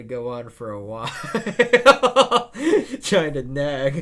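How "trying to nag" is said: high, loud cackle into the words; loud laughter. Real, not acted. Viewer howling with laughter at the nagging old man. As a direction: crack up mid-sentence. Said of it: "laughing at old timer".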